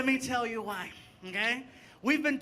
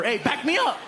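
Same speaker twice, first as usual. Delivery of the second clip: mock call; 3 semitones higher, 6 dB louder than usual. Calling to a gang member.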